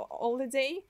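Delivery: normal talking voice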